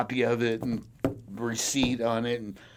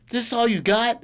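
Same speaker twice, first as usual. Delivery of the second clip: much higher, louder challenge; loud, high scornful cop question; mock cop sneer; 11 semitones higher, 8 dB louder than usual. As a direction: throw it at him like a scornful cop. Cop questioning him during the search.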